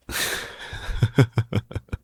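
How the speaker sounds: breathy laugh that drifts up high; a light embarrassed laugh, mostly breath; high airy laugh. Acted. Captor laughing at his own sore neck to ease her guilt.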